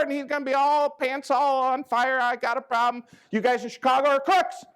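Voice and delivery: mock griping farmer.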